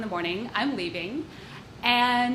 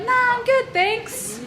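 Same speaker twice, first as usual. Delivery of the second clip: breezy polite no; 12 semitones higher, 3 dB louder, slower than usual. Retold or imitated. Declining candy politely.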